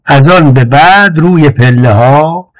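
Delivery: usual speaking voice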